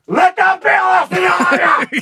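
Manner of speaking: high-pitched hammed-up scream; raging warning. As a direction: scream it like a dad caught on video. Acting video of raging dad.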